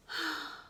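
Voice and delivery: audible discouraged exhale; weary sigh. Acted. Mouse is tired and frustrated, weary sigh.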